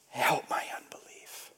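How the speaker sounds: hushed, voiceless whisper; whispered plea. Retold or imitated. Tearful father praying to the Lord, whispered plea. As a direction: whisper it as a confession of doubt. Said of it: "he whispered his doubt".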